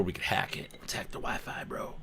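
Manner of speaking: hushed, playful scheming whisper; mock-conspiratorial whisper. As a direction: whisper it like a secret plot. Conspiratorial joke about hacking.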